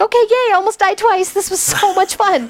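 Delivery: high-pitched mock enthusiasm; sarcastic cheer. Camper after almost dying twice, high sarcastic cheer. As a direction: fake delight at a miserable time. Sarcastic recap of camp disasters.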